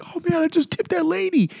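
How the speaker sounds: very high, excited retelling; mock drunken boasting. Drunk friend walking out of Publix, mock excited boast. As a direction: imitate a tipsy man thrilled with himself.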